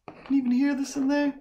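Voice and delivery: calling up high and fast to the cat in her booth; squeaky fast pet-talk. Real, not acted. To her cat in the sound booth, high quick calling.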